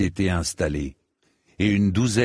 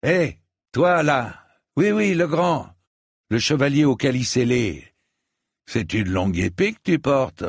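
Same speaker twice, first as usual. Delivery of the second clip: calling out; 6 semitones higher, 3 dB louder than usual. A narrator's reading. Sir Stephen hails Dunk across the practice yard, high and loud. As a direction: hail the big stranger with swagger. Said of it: "aperçut Dunk et s'exclama".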